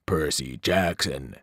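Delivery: low, slow, menacing call from the depths; cold evil voice. Acted. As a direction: call his name like something from a chasm. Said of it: "voice changed until it was cold and evil".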